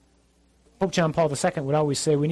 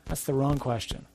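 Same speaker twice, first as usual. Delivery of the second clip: ordinary delivery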